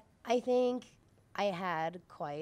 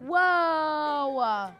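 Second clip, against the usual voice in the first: loud, rising whoa; genuinely amazed exclamation; surprised; 7 semitones higher, 9 dB louder than usual. Real, not acted. Seeing the portraits.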